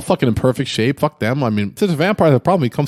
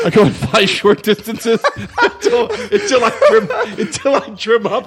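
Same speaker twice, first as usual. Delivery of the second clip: giggling through the words, voice pitched up; laughing while talking; 7 semitones higher than usual. Real, not acted. Laughing at his own joke.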